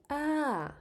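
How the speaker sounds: "ah" starts high then falls down; falling tone ah. Shown as a demonstration. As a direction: lift "ah" high and let it drop.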